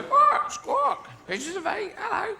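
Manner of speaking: very high parrot squawk; comic parrot voice, pitched right up. Acted. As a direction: screech it like a talking parrot. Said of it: "'Cause parrots".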